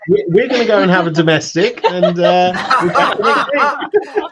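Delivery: laughing loudly through the goodbye; high, loud, breaking into laughter. Real, not acted. Laughing sign-off to viewers.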